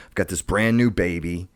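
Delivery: ordinary talk, nothing marked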